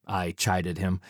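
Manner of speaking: plain reading voice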